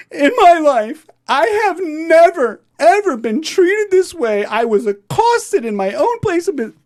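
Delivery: high, loud, slow mock-weepy outrage; imitating offended woman. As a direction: play the offended woman crying to the judge, pitched high. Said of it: "what would you like to say".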